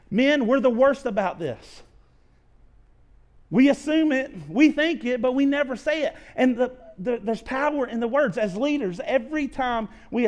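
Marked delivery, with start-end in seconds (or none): 0.1-8.4 louder and higher, pressing the men hard; preaching at full force. Acted. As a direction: preach it like a call to action. Preaching climax.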